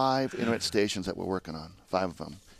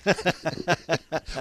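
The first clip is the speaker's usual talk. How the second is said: high laugh; 8 semitones higher than usual. Real, not acted. Laughing at his own joke.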